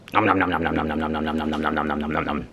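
low, rapid-fire nom-nom noise; mock gobbling. Dad pretending to gobble banana tart, playful munching noise. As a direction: pretend munching in one unbroken low run.

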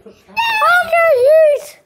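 high, loud cheer; pretend glee. Puppet just granted two wishes, gleeful. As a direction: squeal it like a play victory.

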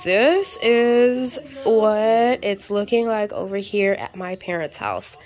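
usual speaking voice

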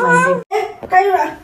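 squeaky high coo. Real, not acted. Mother cooing to the baby at feeding time. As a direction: pop it way up high.

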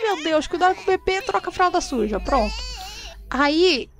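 ordinary delivery

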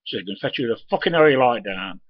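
ordinary delivery

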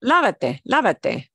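ordinary talk

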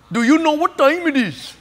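put-on East Indian accent. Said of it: "from an East Indian accent".

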